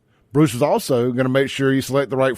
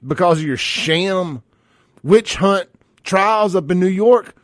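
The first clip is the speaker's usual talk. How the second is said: mocking echo; 5 semitones higher, 4 dB louder than usual. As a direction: throw his line back at him with scorn. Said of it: "I hear you're free on Wednesdays".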